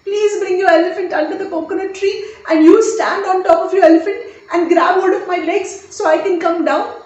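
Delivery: high, urgent begging; dangling man begs the mahout for rescue; pleading for help. Acted. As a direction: plead desperately from above. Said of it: "looking down, saying".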